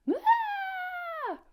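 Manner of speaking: very high, loud, long wail; hammed-up high bawl, held long; mock crying wail. Teacher acting Powder falling apart, high mock bawl. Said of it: "crying and pouting".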